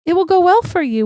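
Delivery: mother hammering the point to her son; emphatic reminder. Retold or imitated. Mom stressing promise to son.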